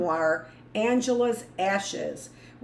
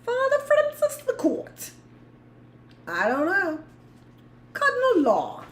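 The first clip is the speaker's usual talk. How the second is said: put-on Irish accent; 11 semitones higher, 3 dB louder than usual. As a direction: play up an Irish accent, pitched way up.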